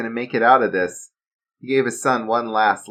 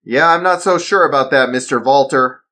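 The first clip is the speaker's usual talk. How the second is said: raised, louder voice standing firm; defiant dad; 5 semitones higher, 7 dB louder than usual. Acted. Father refusing the villain, defiant. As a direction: push back at him.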